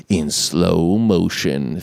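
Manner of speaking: slow-motion drawl. Retold or imitated. Describes the slow-motion hero shot.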